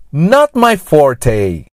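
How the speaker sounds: slow Not my forte with forte as "for-tay"; slow, clear Not my forte, gringo-style forte; gringo accent. Shown as a demonstration. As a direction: model Not my forte in English, forte ending in "tay". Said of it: "pronunciado com sotaque gringo".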